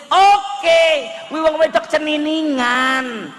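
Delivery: a wife exploding with jealous rage; angry wife shout. Acted. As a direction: shout it high and loud, furious.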